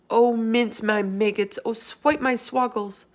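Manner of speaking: slow, loud wailing oaths; alarmed lament. Acted. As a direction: moan out each oath in alarm. BFG in alarm.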